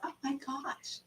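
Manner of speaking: very soft, high-pitched gasp of dismay; small, stunned mock voice; hushed shock. Believer stunned by the apostle's blunt words, hushed shock.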